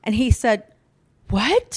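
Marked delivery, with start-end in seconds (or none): 1.3-1.8 high, loud incredulous what; shocked child. Retold or imitated. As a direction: imitate a child's loud disbelief. Acting brother's shocked reply to mother.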